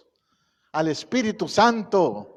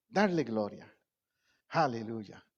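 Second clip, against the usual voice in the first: ordinary talk, nothing marked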